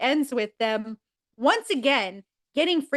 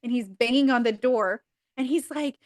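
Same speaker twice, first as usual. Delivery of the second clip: ordinary talk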